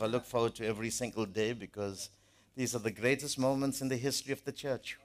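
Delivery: ordinary delivery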